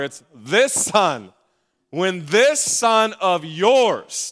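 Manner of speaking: "this son" said very slow and weighted; stress on this. Shown as a demonstration. Pastor quoting the older son to his congregation, slow scornful stress on this. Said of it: "Look at me".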